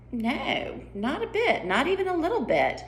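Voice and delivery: high-pitched Duchess, dismissive; breezy Duchess. Acted. Duchess denies being frightened, in a high voice. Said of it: "the Duchess says".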